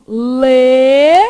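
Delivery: leão held long and loud on one steady rising tone; stretched leão. Shown as a demonstration. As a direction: draw out leão slowly to show the hiatus. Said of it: "parece, ó".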